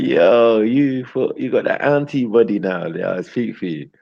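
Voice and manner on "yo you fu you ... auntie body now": high, loud mock call-out; teasing remark. Teasing baby mother about her auntie body. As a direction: tease her like you can't believe it. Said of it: "I'm looking at her like".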